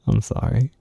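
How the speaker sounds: normal talking voice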